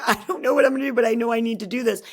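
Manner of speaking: reliving the panic of crying in the car; retold tearful panic. Single mom after a CEO meeting, retelling her tearful, rushed panic. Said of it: "I went into the car and I cried".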